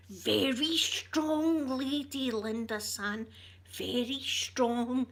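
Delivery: slow, emphatic mock Japanese-English accent on "Very strong"; drawn-out admiring praise, lightly put on; imitating Japanese colleague. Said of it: "they'd go".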